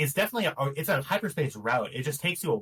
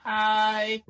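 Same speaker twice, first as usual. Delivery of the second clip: startled shout; 7 semitones higher, 4 dB louder, slower than usual. Acted. Red startled, calling over comms.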